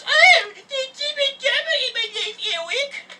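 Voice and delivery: high, crying mock girlfriend voice; hammed-up teary girlfriend impression; mocking whiny ex. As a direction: whine it like a sobbing drama queen.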